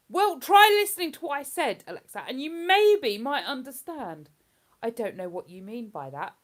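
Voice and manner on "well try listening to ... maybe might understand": louder, higher, scolding the smart speaker; louder exasperated scolding of Alexa; exasperated telling-off. Real, not acted. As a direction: tell Alexa off in frustration.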